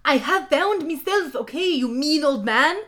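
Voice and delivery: high, loud indignant yell; hammed-up angry shout. A narrator's reading. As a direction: yell it back at an old man. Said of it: "Hagrid yelled".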